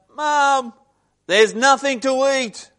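high, loud yelled complaint; teenager's yell. Retold or imitated. Teenager home from school yells to mum, loud complaint. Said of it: "and then they yell out".

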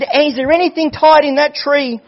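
high, loud acted desperation; anxious urgent question. Young man begging the preacher to check the tree, anxious cry. Son desperate to know the answer.

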